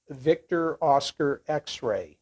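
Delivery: ordinary delivery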